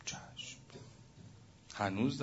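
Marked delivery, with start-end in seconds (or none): 0.1-0.6 whispered yes. Acted. Servant answers humbly in story.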